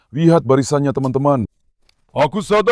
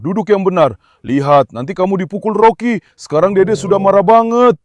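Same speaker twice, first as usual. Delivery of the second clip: normal talking voice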